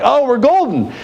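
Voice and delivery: mock triumphant "we've got this"; relieved mock delight. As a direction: tourists think they know the prayer, pitched high. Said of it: "we're like".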